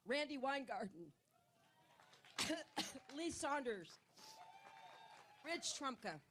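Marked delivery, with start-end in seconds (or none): 2.4-3.1 harsh dry cough; coughing fit. Real, not acted. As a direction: cough hard, can't stop. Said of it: "my allergic reaction".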